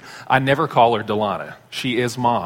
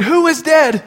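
alarmed question; 7 dB louder than usual. Retold or imitated. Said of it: "I said".

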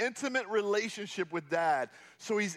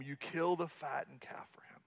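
whispered, softer resentful aside; bitter whisper; 4 semitones lower, 7 dB quieter than usual. Acted. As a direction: hiss the complaint under your breath. Elder son's bitter complaint, scripture.

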